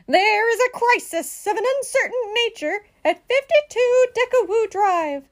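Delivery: high-pitched, officious alarm; dramatic emergency phone call; urgent emergency report. A narrator's reading. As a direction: report it forcefully and self-importantly.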